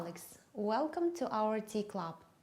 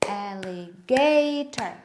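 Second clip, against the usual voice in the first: alligator in loud, slow beats, GA biggest; stress on GA; 9 dB louder, slower than usual. Shown as a demonstration. Let's clap together with the children, stress on GA. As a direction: hit GA hard and long in alligator. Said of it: "you make it high".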